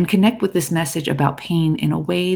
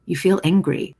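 ordinary talk, nothing marked

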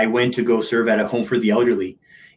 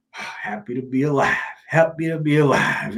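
put-on happy old man; 4 semitones higher, 3 dB quieter than usual. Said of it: "he was ninety-two years old".

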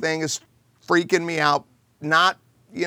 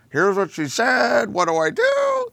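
flustered daughter; 8 semitones higher than usual. Retold or imitated. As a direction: voice jumping much higher on the question, mock panic. Daughter panics to manager.

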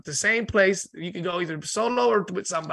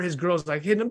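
normal talking voice

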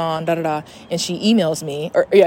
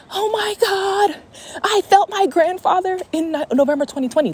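amazed realization; 12 semitones higher than usual. Retold or imitated. Recalling grandfather's touch while hearing podcast, re-enacted amazement. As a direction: relive the moment it clicks, voice pitched way up.

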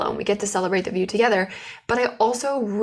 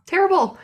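Terrible. thrown much higher and louder, then falling; emphatic repeat; 11 semitones higher, 4 dB louder than usual. Real, not acted. Host mocking herself as an employee, emphatic high Terrible. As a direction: punch Terrible. up high and let it drop.

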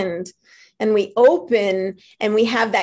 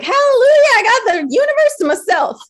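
high, loud hallelujah of delight; gleeful cry of freedom; joyful exclaim; 13 semitones higher, 6 dB louder than usual. Retold or imitated. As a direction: rejoice out loud. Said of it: "I was like".

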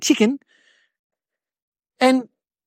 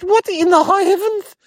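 high-pitched incredulous outcry; astonished; 9 semitones higher than usual. Acted. Boy reacting to a chicken's orders, astonished. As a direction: exclaim it high, in mock disbelief.

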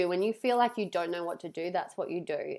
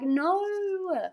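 high drawn-out whining no; high mock-whiny protest; 11 semitones higher than usual. Retold disappointment.

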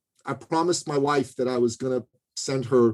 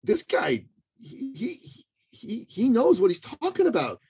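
higher, lightly voiced admiring client; impressed client's thoughts; 9 semitones higher than usual. A client thinks about the coach, mildly impressed. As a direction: play the client who's won over. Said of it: "you're gonna be thinking".

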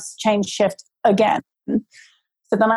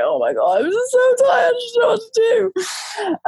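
desperate crying; 14 semitones higher than usual. Retold or imitated. To Mike crashed out asleep, a high crying wail. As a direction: let it crack into tears. Retelling breaking down from exhaustion.

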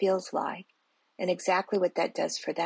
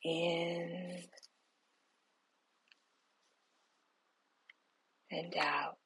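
slow, soft whisper, guiding each breath; whispered guided meditation; 7 dB quieter, much slower than usual. Acted. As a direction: breathe the words out slowly, barely voiced.

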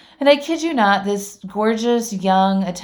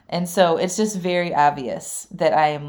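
ordinary talk

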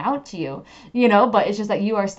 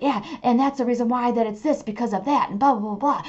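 chattering ego voice; 4 semitones higher, faster than usual. Retold or imitated. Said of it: "It's going to be like".

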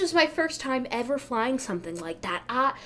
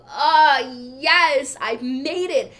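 loud, drawn-out cry of triumph; elated relief; 3 semitones higher, 7 dB louder, much slower than usual. Retold or imitated. Standby passenger thinking she made the flight, elated. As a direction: let the joy burst out loud.

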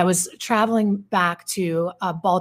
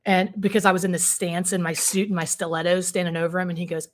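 usual speaking voice

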